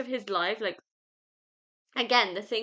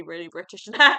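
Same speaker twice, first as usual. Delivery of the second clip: laughing; 2 semitones lower, 14 dB louder than usual. Real, not acted.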